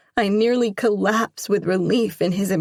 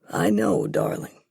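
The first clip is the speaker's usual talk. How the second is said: tender reassurance; 9 semitones lower than usual. A narrator's reading. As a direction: reassure her gently. Father gently reassures.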